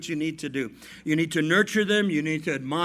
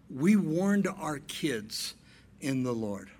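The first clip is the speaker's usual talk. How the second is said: slow emphatic preaching; 3 semitones lower, slower than usual. Shown as a demonstration. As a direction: drive it home slowly. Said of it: "paced preaching".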